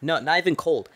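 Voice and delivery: ordinary talk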